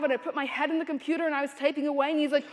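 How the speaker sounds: normal talking voice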